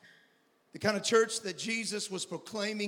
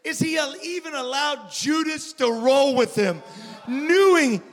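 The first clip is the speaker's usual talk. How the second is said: preaching at full force; 8 semitones higher, 8 dB louder than usual. Acted.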